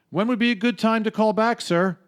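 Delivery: pushy cheerful telemarketer. Retold or imitated. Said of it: "Jerry, on the phone".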